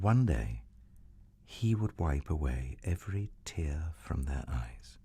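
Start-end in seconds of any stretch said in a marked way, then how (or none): 1.4-5.0 soft whisper; whispered tender promise to listeners. Acted. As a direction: breathe it tenderly like a secret. Tender storytelling climax.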